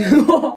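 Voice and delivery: loud high laugh; burst of laughter. Real, not acted. Reactor laughs at the clip.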